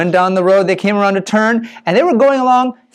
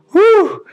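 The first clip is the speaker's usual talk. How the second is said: relieved whew; 12 semitones higher than usual. A narrator's reading. Relief after passing the lion.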